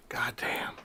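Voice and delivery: breathless whispered "God damn"; awed whisper. Retold or imitated. Uncle hearing punk singles on headphones, whispering in awe. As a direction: hush it out in amazement. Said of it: "he's, like, going".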